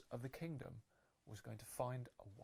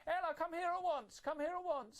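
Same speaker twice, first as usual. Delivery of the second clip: high, loud, bossy shouting stepsister; shrill-voiced wicked stepsister summoning Ella; 5 dB louder than usual. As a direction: order Ella about like a spoilt stepsister. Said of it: "She said".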